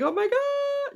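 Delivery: high, drawn-out mock shriek of horror; hammed-up panicked wail; mock scream. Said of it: "They didn't scream and yell".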